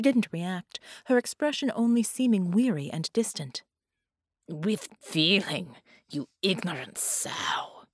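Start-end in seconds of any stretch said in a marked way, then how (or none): 4.5-7.8 contemptuous whisper. A narrator's reading. Bela sneers at his captive.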